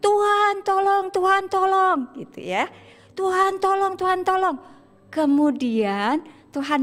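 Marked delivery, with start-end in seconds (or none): 0.0-2.0 high, raised cry for rescue; acted cry for help. Petrus sinking, crying to the Lord, high acted yell. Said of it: "Dia teriak-teriak". 3.1-4.6 high, sustained plea for rescue; acted cry for help. Petrus sinking in the water, high acted cry for help. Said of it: "Dia teriak-teriak".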